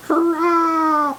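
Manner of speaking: very high, thin piped cheer; piping hobgoblin. A narrator's reading. Tiny hobgoblin piping a cheer at the party. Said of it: "piped a tiny hobgoblin in an almost inaudible voice".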